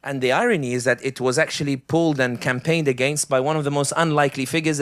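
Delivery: ordinary delivery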